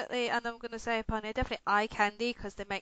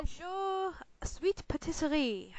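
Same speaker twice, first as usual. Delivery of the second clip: a hammed-up French accent on bonjour; mock French accent greeting; terrible French accent; 5 semitones higher, slower than usual. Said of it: "in my terrible French accent".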